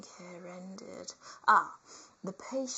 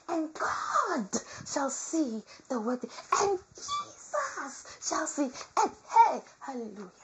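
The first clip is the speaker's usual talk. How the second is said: high, loud hammed-up preacher; mock preaching; 5 semitones higher, 5 dB louder than usual.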